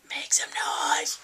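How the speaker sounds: slow whispered slogan. Shown as a demonstration. Vlogger teases towel slogan in a whisper. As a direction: breathe it like a secret.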